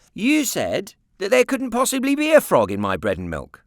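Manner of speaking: put-on high, loud boyish insistence; stubborn child pressing his point; boy insisting. A narrator's reading. Boy to aunt, loud and stubbornly insistent.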